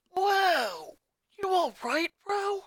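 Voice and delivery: put-on high tomboy girl voice; Lana's voice. A narrator's reading. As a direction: raise the pitch for a young girl. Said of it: "Lana asked".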